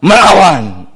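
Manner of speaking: loud drawn-out punch word; sudden loud, stretched declaration; emphatic outburst. Real, not acted. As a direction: burst out with defiance. Punchline word, wife fights back.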